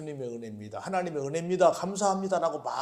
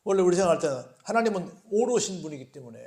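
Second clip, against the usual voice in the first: usual speaking voice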